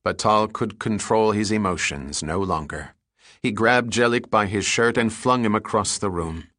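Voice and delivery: plain reading voice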